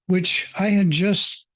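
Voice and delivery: normal talking voice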